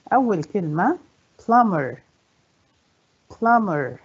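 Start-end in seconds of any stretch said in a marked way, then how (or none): 1.4-2.0 "plumber" as PLUM-ber, falling; stress on first syllable. Shown as a demonstration. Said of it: "يبقى على أول مقطع". 3.3-4.0 "plumber" as PLUM-ber, falling; stress on first syllable. Shown as a demonstration. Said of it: "يبقى على أول مقطع".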